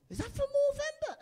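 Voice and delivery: high, loud mocking drunk voice; drunk bloke. Comedian mimics a drunk bloke asking about his tash, hammed high. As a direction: jab the question up high like a pointing drunk. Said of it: "drunk people will go like this and go".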